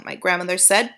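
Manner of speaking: usual speaking voice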